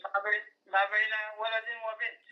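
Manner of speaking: usual speaking voice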